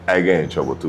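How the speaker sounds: usual speaking voice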